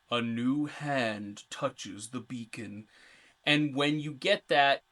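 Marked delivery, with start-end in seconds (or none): 0.1-2.9 slow, drawn-out goddess proclamation; game voice. Acted. Host quoting Meridia's voice from the game, slow portentous intoning. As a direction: intone it as a solemn otherworldly voice. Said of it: "you hear a voice go".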